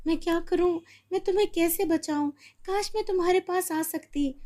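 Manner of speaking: put-on high, fast anxious child voice; wishing she could reach it; girl longing to help the pigeon; helpless girl. Girl's helpless wish.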